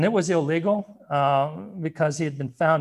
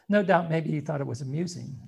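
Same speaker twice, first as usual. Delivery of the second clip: usual speaking voice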